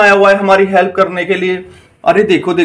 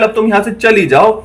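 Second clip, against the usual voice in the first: usual speaking voice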